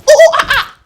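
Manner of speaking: very high, loud chimp hooting; chimp cry. Shown as a demonstration. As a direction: hoot like a jumping chimp. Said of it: "this is how we do a chimp jump".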